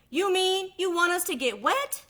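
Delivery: high, loud appalled question; can't believe it; the last duckling horrified at getting wet; dismayed duckling. Acted.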